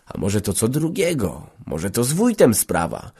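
usual speaking voice

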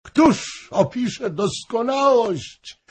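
eyes to the ceiling, lost in adoration; rapturous sigh. A narrator's reading. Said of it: "z podniesionymi w sufit oczyma". (zachwycone, wysokie, powolne westchnienie)

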